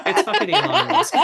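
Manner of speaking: high, laughing blurt; giggling confession. Real, not acted. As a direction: laugh out the name. Laughing admission of the name.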